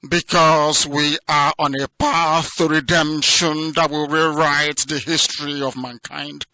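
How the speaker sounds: normal talking voice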